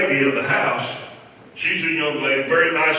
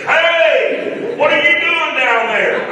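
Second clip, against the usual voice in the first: high, loud call from above; imitated impatient boss yelling; yelling Hey; 12 semitones higher, 5 dB louder, slower than usual. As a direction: holler down at him. Johnny yelling at him under house.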